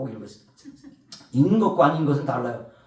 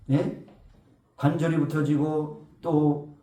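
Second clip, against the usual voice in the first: ordinary delivery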